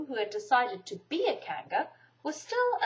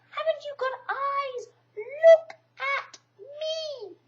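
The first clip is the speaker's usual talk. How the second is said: upset Piglet; 6 dB louder, much slower than usual. A narrator's reading. Piglet upset in bath scene.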